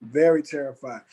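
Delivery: ordinary talk, nothing marked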